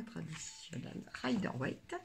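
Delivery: Rider-Waite in a so-so English accent. Shown as a demonstration. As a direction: try an English accent on Rider-Waite, not quite there. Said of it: "mon accent anglais qui n'est pas terrible".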